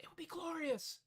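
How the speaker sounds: a gloating secret whisper; gleeful whisper. Acted. Picturing marchers slipping on lube, whispering gleefully. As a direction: whisper it, savouring the thought.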